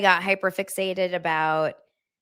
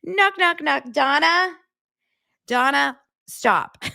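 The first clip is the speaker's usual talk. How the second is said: mock high-pitched door-knocking call; Blake Lively; 10 semitones higher, 3 dB louder, slower than usual. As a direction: chant it playfully high, then drop on the last word. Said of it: "Blake Lively is gonna be like".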